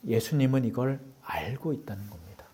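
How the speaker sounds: hushed whisper, leaning in; a sorrowful whisper to the congregation. Real, not acted. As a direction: whisper it like a sad secret. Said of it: "confides the sad point".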